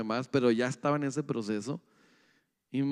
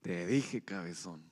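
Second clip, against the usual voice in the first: low, soft muttered told-you; mother's told-you; 9 semitones lower, 9 dB quieter, slower than usual. Retold or imitated. Mother quietly scolding her hurt child, low and soft. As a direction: drop low and soft, a knowing reproach.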